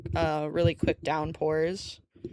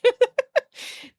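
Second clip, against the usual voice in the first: high laugh; 4 dB louder than usual. Real, not acted. Teasing chat about their fault.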